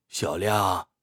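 low, weary lament; sighing. Acted. Old man confiding in his young companion over drinks, sighing. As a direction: begin as if with a sigh. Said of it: "叹息道".

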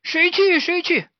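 high excited calls; high shrill excited shout; monkeys shouting. A narrator's reading. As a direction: monkeys calling out to volunteer. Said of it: "大家都喊".

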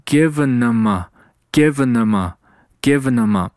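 "given them" slurred into "givenuma"; repeated slow demo of "given them" as "givenuma"; linked pronunciation. Shown as a demonstration. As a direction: slowly join "given them" and a following "a" as one word. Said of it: "所以说连在一起".

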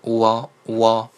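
乌凹 run together as one gliding wa sound; teacher's blended 乌凹, repeated for learners; linked vowels. Shown as a demonstration. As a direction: glide from 乌 straight into 凹. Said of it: "乌凹连读就可以了".

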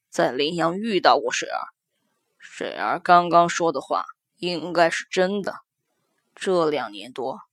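a weary official father confiding; middle-aged man voice. Acted. Son speaks to his mother.

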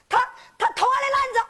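high, fast, stammered accusation; complaining youngster. Acted. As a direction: blurt out the complaint high and quick. Child complains to teacher in story. (孩子高声急忙告状)

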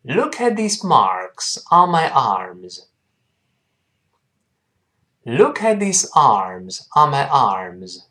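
slow, drawn-out model sentence; slow reading. Shown as a demonstration. Teacher leads learners through this sentence, slow reading. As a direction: read it slowly, stretching the key words. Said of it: "跟老师慢慢读一下这句话".

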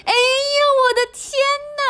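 very high, startled wailing cry; hammed-up little old lady getting a fright; frightened old woman. A narrator's reading. Old woman startled by the lion, crying out high. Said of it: "瘦小的老妇人喊起来".

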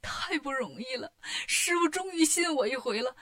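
acted weepy relief; hammed-up tearful joy, voice choked with sobs; happy tears. A narrator's reading. Wukong is finally believed by his master, happy weeping. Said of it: "开心的是泪流满面呢".

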